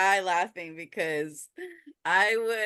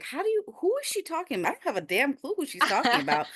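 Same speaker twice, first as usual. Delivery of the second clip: confused friend; 9 semitones higher, faster than usual. Retold or imitated. Voicing friend's confused texts.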